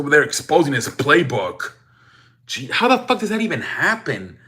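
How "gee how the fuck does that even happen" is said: pitched way up in disbelief; incredulous outburst. Real, not acted. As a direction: throw it up high, like you can't believe it. Outraged at exposed playbook.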